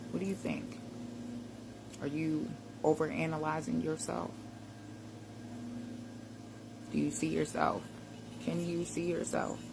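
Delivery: gentle, unhurried coaching voice; guided reflection. Acted. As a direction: lead them calmly through the mirror check. Guides a mirror self-check.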